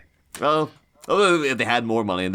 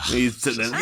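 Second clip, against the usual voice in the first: ordinary talk, nothing marked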